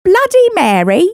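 high-pitched mock British question; put-on British accent. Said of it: "I'm putting on an accent".

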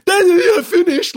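very high, loud, squealed child mockery; mocking politeness. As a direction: shriek the polite words in mockery. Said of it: "like a mockery of the politeness".

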